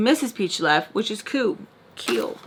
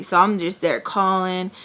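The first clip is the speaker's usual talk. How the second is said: ordinary talk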